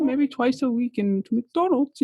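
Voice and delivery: put-on high, breezy South African accent. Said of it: "in her little South African accent".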